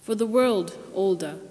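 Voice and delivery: usual speaking voice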